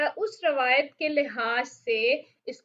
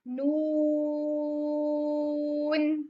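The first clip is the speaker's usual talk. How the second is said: नून drawn out very slowly; recited letter; 2 semitones higher, much slower than usual. Shown as a demonstration. Teacher showing the letter name, drawn-out recitation. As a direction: hold नून long like recitation. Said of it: "बिल्कुल ऐसे यहाँ पर देखें".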